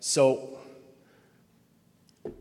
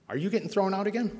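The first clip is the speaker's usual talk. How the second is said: put-on higher, softer little-kid question; 孩子的口吻; 5 semitones higher, 4 dB quieter than usual. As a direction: lightly imitate a curious younger sibling, pitched up. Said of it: "they look up, and they say".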